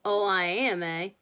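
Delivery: put-on bully's comeback; challenging cry. A narrator's reading. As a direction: throw it back as a challenge. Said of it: "cried Danny".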